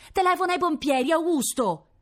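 high, loud call down from the balcony; woman in nightgown shouting to the waiter from her balcony. Acted. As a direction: yell down to Augusto below. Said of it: "gli gridò dal balcone".

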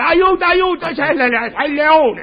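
high panicked yell for help; comic terrified shout; screaming for help. Retold or imitated. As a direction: shriek it like a lion is on you. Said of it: "ho cominciato a gridare".

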